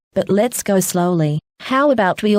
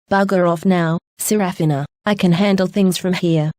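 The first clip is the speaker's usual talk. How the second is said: usual speaking voice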